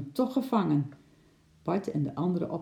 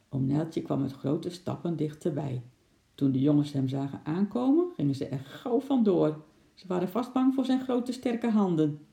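plain reading voice

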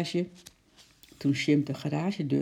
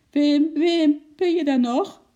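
loud, very high call; anxious wife calling out from afar; bezorgd roepen; 12 semitones higher, 9 dB louder, slower than usual. A narrator's reading. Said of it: "riep de mevrouw". (hoog en luid bezorgd roepen)

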